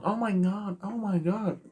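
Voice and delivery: high, breathy-free American "oh my god" as Lana; imitating Lana with a high American accent. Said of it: "Very American accent, doesn't she?".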